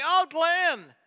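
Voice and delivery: a lightly voiced doubting listener, high on Glenn; imagined listener objecting. Pastor voicing a listener who objects to spoilers, light mock protest. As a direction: play a mildly protesting churchgoer. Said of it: "And you might say".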